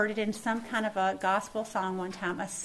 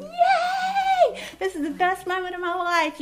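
much higher, louder whoop of celebration; cheering yay; 10 semitones higher, 7 dB louder, slower than usual. Pretend. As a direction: cheer like confetti is falling. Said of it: "just going".

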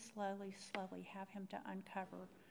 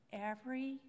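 usual speaking voice

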